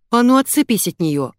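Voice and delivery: ordinary delivery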